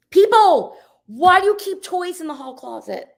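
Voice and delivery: comic outrage, pitch jumping high; loud disbelief. Real, not acted. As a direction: call people out, loud and incredulous. Storyteller exclaims at absent people.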